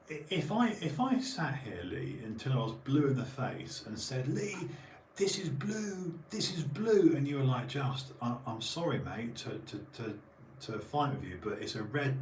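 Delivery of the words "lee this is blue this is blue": slow, high mock-insistent repetition; mock insistence. Mocking Lee, insisting blue mic, high and slow. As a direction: drag it out like a stubborn child.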